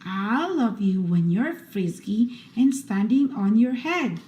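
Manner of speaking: ordinary delivery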